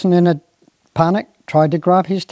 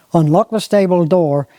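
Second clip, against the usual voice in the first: normal talking voice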